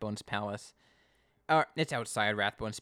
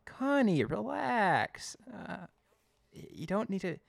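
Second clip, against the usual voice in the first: mock calming; 6 semitones higher than usual. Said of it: "I'm like".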